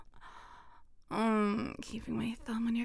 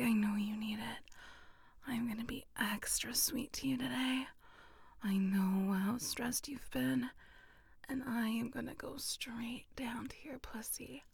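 soft unvoiced whisper, close to the ear; intimate whisper; 2 semitones higher, 5 dB quieter than usual. Whispering close to a partner, intimate and teasing. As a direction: breathe every word like a secret to a lover.

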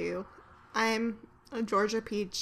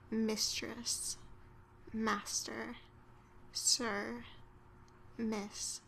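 soft, sultry and seductive; hushed, softer bedroom voice; sexy; 8 dB quieter than usual. Shown as a demonstration. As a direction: purr each word to tempt a lover. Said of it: "I would do it with a little bit more, um, sexiness".

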